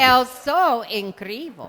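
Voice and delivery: loud Portuguese with an American accent; English accent Portuguese. Real, not acted. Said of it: "diga pra moça do seu lado".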